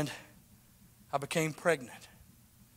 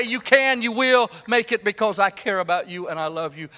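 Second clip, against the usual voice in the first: high, ringing, much louder promise; loud voice of Jesus; 6 semitones higher, 8 dB louder than usual. Acted. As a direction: proclaim it as Jesus' own words. Preacher voices Jesus speaking.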